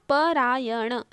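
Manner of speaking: परायण split up, "pa-raa-ya-na"; separated sounds with a full "pa" in परायण; sounded out. Shown as a demonstration. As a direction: dictate परायण syllable by syllable. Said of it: "ऐसा लिखते हैं आप".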